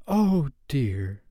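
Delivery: put-on high, gentle little-girl sigh of words; tender, hesitant girl; Wendy's voice. A narrator's reading. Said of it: "Wendy said".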